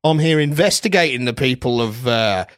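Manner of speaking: hammed-up Australian accent, laughing at the end; mock Australian accent, breaking into laughter. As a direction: keep up the broad Aussie character. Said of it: "as you can tell by my accent".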